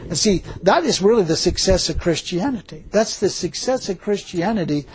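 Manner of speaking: normal talking voice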